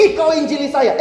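a light mock of an annoyed friend with a laugh. Preacher voicing a friend who feels evangelized. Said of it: "Kalau dia bilang".

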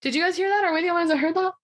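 high, alarmed questions; suddenly spooked and asking around; startled. Real, not acted. Startled by ghost voice on tape.

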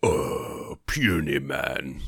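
deep slow monster growl; low, slow four-armed brute; Goro voice. Retold or imitated. Quoting Goro in movie.

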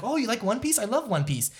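high-pitched, delighted mock enthusiasm; gushing fan. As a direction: imitate an eager fan, pitch way up. Weird killer gushes over One Piece.

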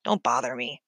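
low, soft grumble; grumbled. A narrator's reading. Father to his daughter, low grumble. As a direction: brush someone off under your breath. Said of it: "Her father grumbled".

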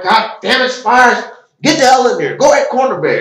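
high, loud yelling coach impression; mock coach barking an order; imitating the coach. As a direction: yell it like a furious old coach. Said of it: "that's how he used to talk".

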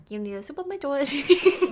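loud, high, giggly quote; imitated show line. Quoting Succession to a friend, silly imitation. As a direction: put on a silly voice and laugh through it. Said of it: "The Succession".

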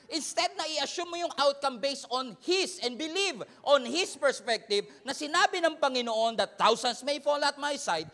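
loud, rising sermon voice quoting psalms; full-voiced pulpit preaching; preaching at full force. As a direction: preach it out with growing fire.

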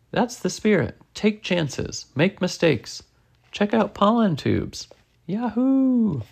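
high, excited shout; excited yell. A narrator's reading. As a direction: cheer them on as you jump. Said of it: "she yelled".

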